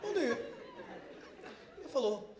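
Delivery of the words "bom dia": higher, relaxed, totally composed "Bom dia"; very cool and collected. Retold or imitated. Greeting the flight attendant, playing it totally cool. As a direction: greet her as if nothing is wrong. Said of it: "muito pleno".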